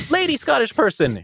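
high-pitched lady character voice; lady Scottish character. Shown as a demonstration. Narrator showing a switch between characters, high lady Scottish voice. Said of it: "going from Scottish person ... to".

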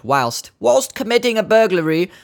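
put-on British accent. Host reading a police tweet in mock British accent. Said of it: "that word is so British".

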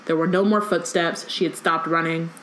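usual speaking voice

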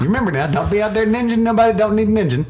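very high, loud, fast slurred hillbilly voice; drunken hillbilly imitation. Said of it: "drunken hillbilly guy".

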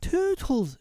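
high-pitched desperate call; crying out. A narrator's reading. Wendy in her extremity calling Tootles, high cry. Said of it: "she cried".

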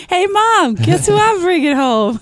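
high, loud, drawn-out call to mom; hammed-up happy shout to a parent; mock excited announcement. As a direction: call home with mock pride, slowly and loudly. Joke call home to mom.

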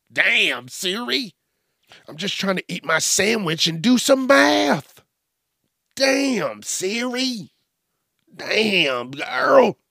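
loud, high comic protest; hammed-up indignant astonishment, voice shooting up; mock exasperation. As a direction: complain in exaggerated disbelief at the sass. Acts protesting to sassy Siri.

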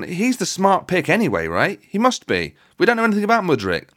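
usual speaking voice

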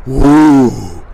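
loud breathy blow onto flames. Retold or imitated. Fanning the dying fire with a loud blowing breath.

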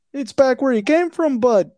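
high, hammed-up Canadian accent; bad Canadian accent. Mock Canadian answers demand for money, bad Canadian accent. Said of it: "I can't do a Canadian accent".

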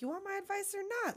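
high, quick retold snap; snapping. Crow retelling how he snaps at her, snappy. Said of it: "he snaps at her again".

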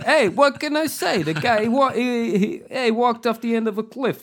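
high, loud mock-menacing Bill Burr; breezy veiled threat, Bill Burr style; Bill Burr impression. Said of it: "I don't know why I'm speaking like Bill Burr".

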